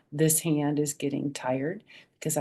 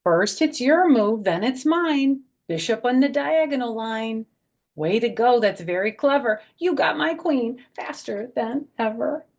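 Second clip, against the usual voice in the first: higher, louder lively rhyme; reading to children; 8 semitones higher, 6 dB louder than usual. A narrator's reading. Grandma reading chess page to kids, lively. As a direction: play up the chess game. Reads book text.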